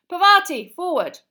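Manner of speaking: loud, high-pitched command; teacher's shout. A narrator's reading. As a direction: shout the order across a classroom. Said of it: "Professor Lupin shouted".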